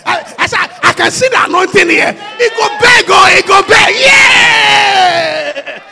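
shouted, high sermon climax; preaching at full force. Real, not acted. Preacher shouting to the congregation, full force.

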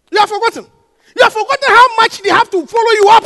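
fiery sermon delivery; preaching at full force. Real, not acted. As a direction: hammer it home to the church.

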